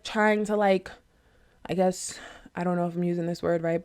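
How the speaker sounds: ordinary delivery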